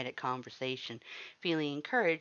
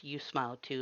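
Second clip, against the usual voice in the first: ordinary delivery, nothing marked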